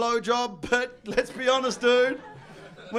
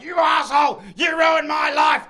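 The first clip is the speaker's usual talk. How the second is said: mock furious wife; 4 semitones higher, 6 dB louder than usual. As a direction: scream it like a wronged wife. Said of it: "When she's like".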